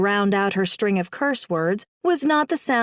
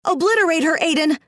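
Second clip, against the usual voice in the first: high shrieking command; 7 semitones higher, 3 dB louder, faster than usual. Acted. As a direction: shriek it like a deranged chicken. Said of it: "the fake Ash shrieked".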